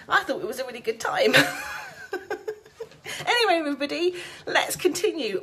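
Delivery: talking through laughter; laughing. Real, not acted. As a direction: giggle through the words. Laughing after the interruption.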